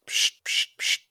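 short unvoiced grinder hisses; can opening hiss. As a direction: hiss out quick grinding passes. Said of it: "I'll start on the belt grinder".

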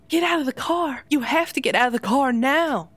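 high, loud desperate command; urgent warning. Acted. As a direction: yell her out of the car before it's too late. Etta urgently warns Lainey.

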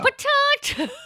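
put-on laughing "Potato" very high and loud in an Australian accent. Showing off an Australian accent while laughing. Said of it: "that is a potato".

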